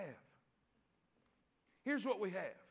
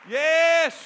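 loud, raised pulpit cry with stretched key words; full-force preaching; 5 semitones higher, 10 dB louder, slower than usual. Real, not acted. Preacher rallying the congregation over death's defeat, full-force preaching. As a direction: build to a shouted, triumphant climax.

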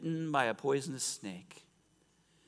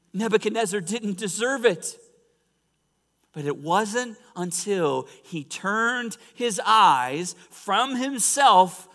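high, loud, emphatic sermon delivery; full-force preaching; 9 semitones higher, 10 dB louder, slower than usual. Real, not acted. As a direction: drive it home to the congregation.

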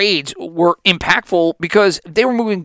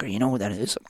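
softer, conspiratorial hush; hushed coworker; 6 semitones lower, 10 dB quieter, faster than usual. Retold or imitated. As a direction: lean in and ask it quietly. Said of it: "Why are we all whispering here".

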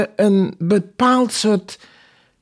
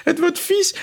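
half-amused inner thought; 9 semitones higher than usual. Retold or imitated. Relives thought while reading on.

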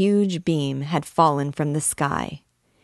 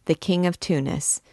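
plain reading voice

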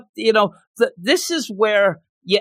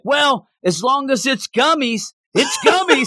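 silly raised voice climbing higher at the end; mock gummies voice; 6 semitones higher than usual. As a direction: announce the rule like a smug cartoon. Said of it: "gummies is like".

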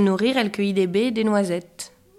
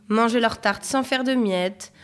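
ordinary delivery

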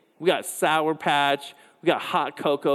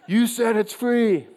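mock kid voice, laughing; 9 semitones higher, 4 dB louder, slower than usual. Kid's excuse, voiced by pastor.

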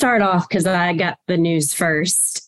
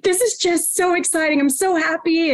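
high, giddy, happy voice; imitating giddy husband; 9 semitones higher than usual. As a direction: gush like a delighted husband. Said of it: "Are you laughing?".

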